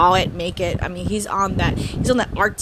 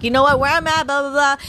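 dismissive tourist; 6 semitones higher, 4 dB louder, faster than usual. Retold or imitated. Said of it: "They're like".

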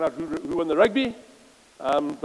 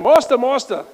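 mock pleading; 6 semitones higher, 8 dB louder than usual.

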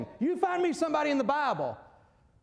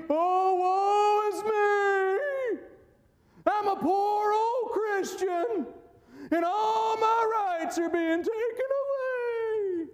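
pouty whining; 8 semitones higher, much slower than usual. Acted. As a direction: ham up a self-pitying moan. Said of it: "You don't find the pouty".

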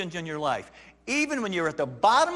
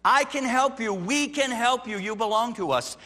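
reassuring government; 6 semitones higher, 4 dB louder than usual. Pretend. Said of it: "citizens that say".

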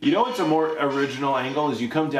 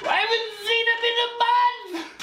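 frantic, very high screech; screeching accented mother; faster than usual. Acted. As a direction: screech it in a garbled put-on accent. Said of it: "what even is this accent".

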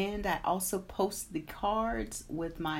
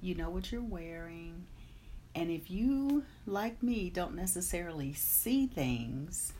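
guided meditation; 4 dB quieter than usual. Acted. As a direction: guide them calmly into the day. Leads a meditation.

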